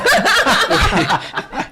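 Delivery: high burst of laughter; big laugh. Real, not acted. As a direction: laugh out loud. Gargalhada após comentário. (gargalhada aguda)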